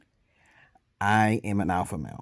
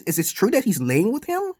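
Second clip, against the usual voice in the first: ordinary talk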